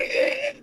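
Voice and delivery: very high imitated piggy squeal. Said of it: "squeal like a piggy".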